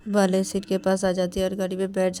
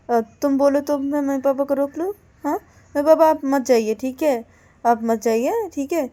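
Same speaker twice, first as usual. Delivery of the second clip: raised, louder voice, pressing appeal; urgent insisting; 6 semitones higher, 7 dB louder than usual. Acted. Partner offers to stop the departing parents, urgent insisting. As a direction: insist earnestly, pitched up.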